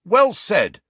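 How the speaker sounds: loud, sharp pompous bark. A narrator's reading. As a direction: bark it out like a prefect. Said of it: "barked Ernie Macmillan".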